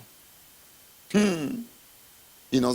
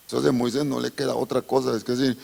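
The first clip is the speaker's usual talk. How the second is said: usual speaking voice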